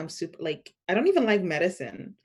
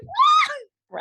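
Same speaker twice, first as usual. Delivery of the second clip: high, loud cry of alarm; shocked yelp; 7 dB louder than usual. Retold or imitated. Patient squealing in shock at the nurse. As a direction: squeal it out in disbelief. Shocked at being told relax.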